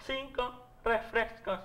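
slow, soft nasal mumble; nasal gangoso voice. Retold or imitated. Juanito repeats his order, a slow soft nasal voice. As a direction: let "cinco refrescos" fall slowly through the nose. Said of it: "era gangoso".

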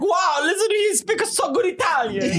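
mock Italian gushing over a tourist's Italian. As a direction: gush like a thrilled Italian. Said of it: "they're like".

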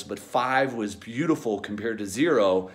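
normal talking voice

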